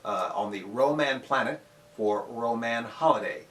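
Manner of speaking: usual speaking voice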